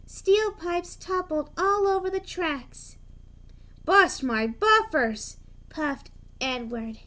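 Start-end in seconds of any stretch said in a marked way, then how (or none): 3.8-5.4 slow, louder shocked exclamation; startled engine oath; dismayed. A narrator's reading. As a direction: blurt it as the pipes topple. Edward shocked at crash.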